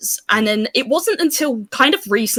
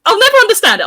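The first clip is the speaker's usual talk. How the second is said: high, loud disbelief on "never"; exasperated; 13 semitones higher, 7 dB louder, faster than usual. Real, not acted. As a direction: throw up your hands at it. Exasperated about being bullied.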